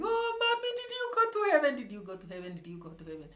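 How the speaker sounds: put-on high wailing child's cry, fading away; imitating a crying child. Child crying for mommy at three in the morning, imitated wail. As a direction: wail it like a scared four-year-old, then let it die down. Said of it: "the child started crying and saying".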